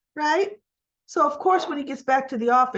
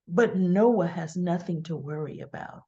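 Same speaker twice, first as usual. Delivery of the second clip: low, sly imitation; Audra's side-mouth insinuation; 4 semitones lower than usual. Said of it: "like, out of the side of the mouth".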